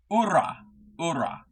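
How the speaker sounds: "Ura" with no H and a rolled R. Shown as a demonstration. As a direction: practise rolling the R in "Ura", silent H. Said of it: "Oh, roll the R?".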